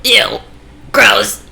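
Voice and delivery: high, loud disgust. Real, not acted. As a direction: recoil in disgust. Reacting to disgusting act.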